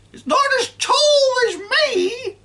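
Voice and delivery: put-on loud screeching old woman, boastful; grandma boasting down from the roof; high sing-song grandma call. A narrator's reading. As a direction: sing it out, bragging. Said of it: "Grandma sang out".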